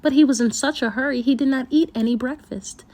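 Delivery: usual speaking voice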